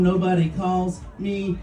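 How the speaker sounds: usual speaking voice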